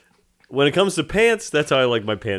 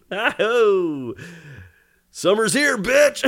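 excited shout; 7 semitones higher, 4 dB louder, much slower than usual. Pretend. Mock cry while throwing balloons.

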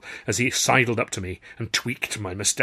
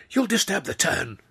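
much higher prim scolding; prim hiss; 9 semitones higher than usual. A narrator's reading. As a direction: tick him off primly. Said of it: "Tilly hissed again".

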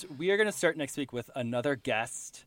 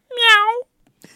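a high, loud, drawn-out meow; cat meow; 14 dB louder than usual. Pretend. Cat meow as a movie clue. Hint given as a cat sound.

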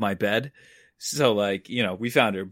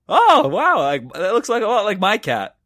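high, loud "oh wow", easing off; mock amazement; 6 semitones higher, 5 dB louder than usual. Man shown a lost cat's picture, mock amazed. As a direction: overplay an impressed stranger's wonder. Stranger admires the cat picture.

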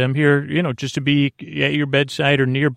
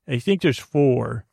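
ordinary talk, nothing marked

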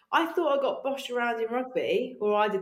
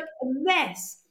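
normal talking voice

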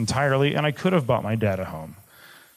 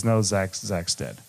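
low and softer, falling away; broken; 5 semitones lower, 4 dB quieter, slower than usual. Retold or imitated. Father repeating the death news, low and broken. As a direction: barely get the words out. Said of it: "I heard my dad just broken".

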